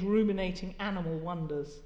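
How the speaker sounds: usual speaking voice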